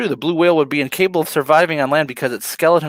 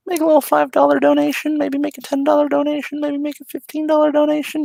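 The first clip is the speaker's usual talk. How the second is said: much higher, singsong upsell; playful high voice; 13 semitones higher than usual. Pretend. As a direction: teasingly climb in pitch.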